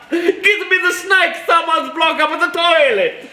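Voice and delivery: high, slow Italian-American plumber; laughing Italian-American Mario voice; Italian-American accent. Pretend. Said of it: "Italian-American accent".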